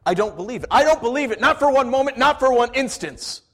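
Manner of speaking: high, loud building defiance; defiant refusal. Acted. Thomas pushing past James, loud defiant refusal.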